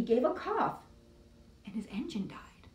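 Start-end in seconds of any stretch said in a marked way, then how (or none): 1.6-2.7 whispered fading line. Acted. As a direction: let it die away to a whisper. Engine dies in story.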